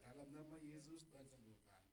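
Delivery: hushed prayer. Retold or imitated. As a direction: murmur a discreet healing prayer. Retelling a discreet healing prayer. (berdoa lirih, makin pelan)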